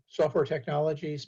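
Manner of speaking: ordinary talk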